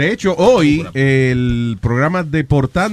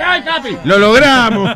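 high excited cheer; joyful shout; 9 semitones higher, 4 dB louder, slower than usual. Acted. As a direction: cry out in triumph.

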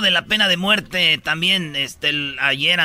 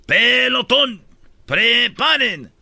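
firing squad commander; 6 semitones higher, much slower than usual. Retold or imitated. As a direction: bark the orders to the firing squad, slowly. Firing squad officer gives orders.

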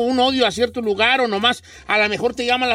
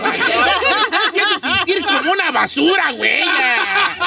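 laughing protest; 6 semitones higher than usual. Real, not acted. Mock offended after being teased.

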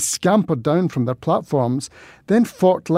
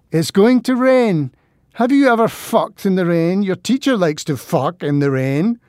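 hysterical and mean; 4 semitones higher, 3 dB louder than usual. Acted. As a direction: jeer at him, unhinged and spiteful. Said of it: "Her voice sounded hysterical and mean".

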